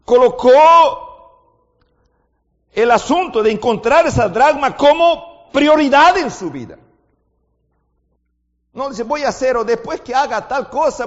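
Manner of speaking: loud, high, driving sermon voice; impassioned preacher's climax; preaching at full force. Acted. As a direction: preach it out with fire.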